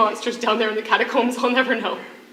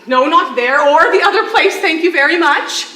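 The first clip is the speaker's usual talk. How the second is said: loud, higher, laughing mock indignation; laughing protest; 6 semitones higher, 10 dB louder than usual. Prisoner laughingly denies where she hid the photo, loud and amused. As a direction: laugh off the crude guess, flustered. Laughing denial of crude guess.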